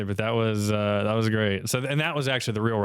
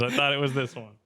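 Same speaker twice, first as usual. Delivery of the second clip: normal talking voice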